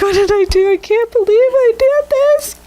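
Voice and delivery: squeaky, exaggerated tearful lament; mock crying. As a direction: wail it like a hammed-up breakdown. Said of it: "I start crying and I'm like".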